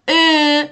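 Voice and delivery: «ا» as a long, loud fronted ö; Turkish Ö vowel. Shown as a demonstration. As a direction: round the lips on a front e for «ا». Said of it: "این حرف رو دقت کنید تلفظشو". («ا» کشیده با صدای ö ترکی)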